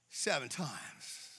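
mock-modest reveal. Peter offering his number to Jesus, mock modest. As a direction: let "seven times" drop softly for effect.

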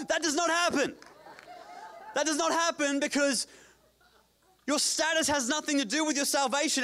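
high, driving sermon delivery; fired-up preacher building to a shout; impassioned preaching. Real, not acted. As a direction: preach it hard to the back row. Preacher at full force.

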